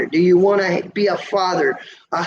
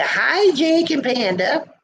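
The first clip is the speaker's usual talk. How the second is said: sing-song greeting; 8 semitones higher, slower than usual. Retold or imitated. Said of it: "they say".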